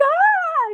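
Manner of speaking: sudden high loud shriek of panic; mock panic scream. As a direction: shriek in playful terror. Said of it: "I'm like".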